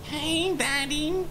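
very high mock come-on; imitated flirty voice. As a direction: copy the squeaky flirt for laughs. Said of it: "I know you're not doing that".

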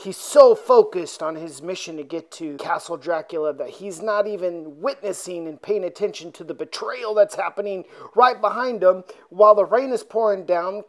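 ordinary delivery

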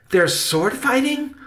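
very high, loud, amazed question; high-pitched delighted disbelief; excited exclamation. A narrator's reading. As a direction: burst out like a thrilled child. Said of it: "Benny exclaimed".

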